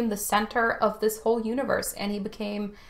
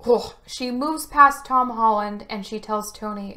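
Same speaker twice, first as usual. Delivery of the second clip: normal talking voice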